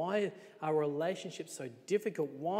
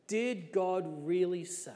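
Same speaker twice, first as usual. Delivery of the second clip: slow, sly voice of the snake; lightly voiced tempter, drawing it out; crafty serpent; 4 semitones higher, 4 dB louder, slower than usual. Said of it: "he speaks to the woman".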